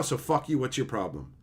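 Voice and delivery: usual speaking voice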